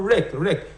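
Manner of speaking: "rec" hit hard and repeated; stressed syllable demo. Shown as a demonstration. Teacher showing where stress falls in correction, emphatic "rec". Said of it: "should be capitalized".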